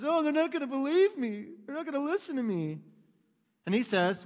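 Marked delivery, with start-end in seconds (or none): 0.0-2.8 high whiny complaint; a hint of a sulking Moses. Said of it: "'Cause Moses says".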